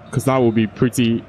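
normal talking voice

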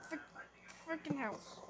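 a whispered curse at the door; whispered grumble; annoyed whisper. Real, not acted. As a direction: whisper it in irritation. Locked out in the rain.

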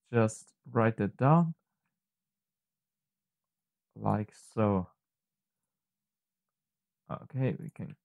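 normal talking voice

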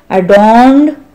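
adorned lifted on a rising tune; stress on adorned. Shown as a demonstration. Teacher reading to a class, holding up the word. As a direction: hold up adorned as the word to learn. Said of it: "make more beautiful".

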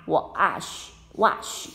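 "wash" said with an open ah vowel; "wash" modelled with the ah sound; pron demo. Shown as a demonstration. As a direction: stretch the ah in "wash". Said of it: "就是念R".

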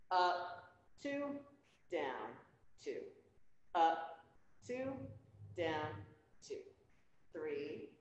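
soft, steady rep-count cadence; counting workout reps. Real, not acted. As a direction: count the reps out evenly, low-key. Instructor counting exercise reps.

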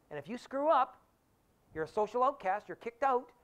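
2 a stern king raising his voice to warn; 1 king's threat. Retold or imitated.

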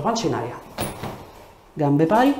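ordinary delivery

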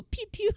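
high mock pew pew. Teasing the noble kids' magic with a squeaky mock pew pew. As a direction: play the blast sound effect with your mouth, way up high. Imitating kids casting magic.

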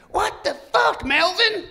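high, loud exasperated yell. Retold or imitated. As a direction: snap at your buddy. Leader yells at Melvin.

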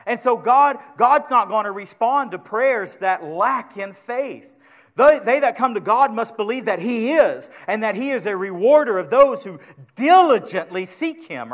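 loud, high, rising sermon cry; full-force preaching. Acted. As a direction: preach it out to the whole church.

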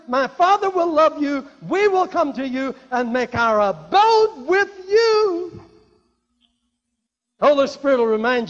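loud, high, impassioned scripture; preaching at full force. Acted. Preacher proclaiming Jesus' promises to the church, at full force.

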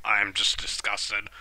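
ordinary talk, nothing marked

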